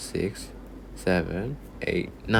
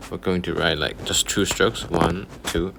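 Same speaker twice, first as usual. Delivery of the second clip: ordinary talk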